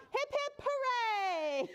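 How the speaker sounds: very high hip-hip, falling hooray; gleeful cheer. A narrator's reading. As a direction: cheer like an overjoyed silly duck. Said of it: "when he added".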